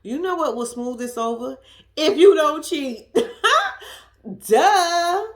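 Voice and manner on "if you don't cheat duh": loud laugh breaking through the line; laughing punchline. Real, not acted. To the viewers, mocking Derek while laughing out loud. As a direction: laugh at your own joke, then a loud duh.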